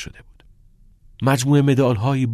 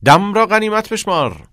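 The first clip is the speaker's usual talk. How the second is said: high, loud teacher's proclamation; ringing, emphatic motto; emphatic repeat; 11 semitones higher, 9 dB louder than usual. Acted. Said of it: "کتینگ تکرار کرد".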